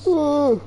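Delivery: long high yawned "ooh"; yawning mid-stream. Real, not acted. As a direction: let a real yawn rise up and out. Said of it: "Sorry, I yawned".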